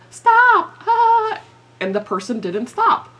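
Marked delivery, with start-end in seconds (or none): 0.1-1.4 put-on high little-girl squeal; child's protest. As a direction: squeal stop like a tickled kid. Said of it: "and Olivia said".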